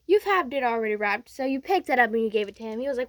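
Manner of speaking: plain reading voice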